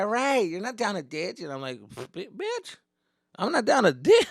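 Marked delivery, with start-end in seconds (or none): 2.1-4.3 pitched way up, loud comeback; indignant retort. Retold or imitated. To a girl teasing him, high indignant retort. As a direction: bite back at her, high and loud.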